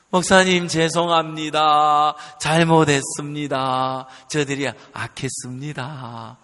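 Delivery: retold penitent apology, low and sinking; contrite apology. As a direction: confess humbly, voice dropping away at the end. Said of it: "죄인이 되면서 마음이 다 무너져 내리고".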